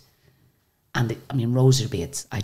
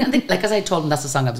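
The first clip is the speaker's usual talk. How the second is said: usual speaking voice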